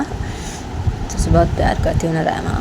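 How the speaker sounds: whispered, slow and tender plea. Retold or imitated. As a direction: coax her gently, barely voiced. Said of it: "तो बानी बोलती है".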